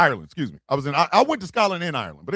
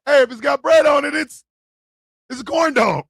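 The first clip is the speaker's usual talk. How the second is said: louder and higher; shout; 6 semitones higher, 5 dB louder than usual. Real, not acted. Defending the corn dog, shouting higher. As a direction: yell it as a defense.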